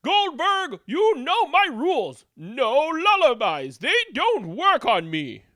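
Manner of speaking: high, loud scolding mom; Goldberg's mom. Acted. Mom scolds Goldberg about lullabies, high loud scolding. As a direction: scold him like a strict mother. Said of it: "Goldberg's mom".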